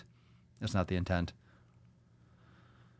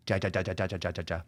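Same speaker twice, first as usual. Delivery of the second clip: Spanish text laugh; 4 semitones lower, 6 dB quieter, much faster than usual. Shown as a demonstration. Host showing all those J's, mock Spanish laughter. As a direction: lower run of repeated "ja", fading. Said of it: "All those J's".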